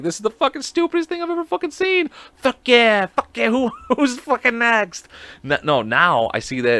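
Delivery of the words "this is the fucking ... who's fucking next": much higher, hammed-up squeal through laughs; mock her cheering while cracking up; laughing mimic. Host mimicking the woman in the video, high laughing squeal.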